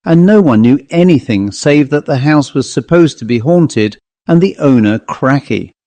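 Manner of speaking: usual speaking voice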